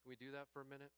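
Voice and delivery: usual speaking voice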